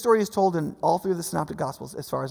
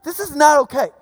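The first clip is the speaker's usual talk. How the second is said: loud, raised voice of rebuke; angry; 7 semitones higher, 7 dB louder than usual. Retold or imitated. Preacher as Jesus rebuking the disciples, angry. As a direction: imitate a forceful telling-off. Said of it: "Jesus gets really angry".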